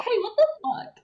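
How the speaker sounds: high-pitched, giggly indignation; mock outrage. Elena to Bonnie who tore the pillow, high mock outrage. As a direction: squeal a mock-offended protest. Repeats Elena's shocked protest.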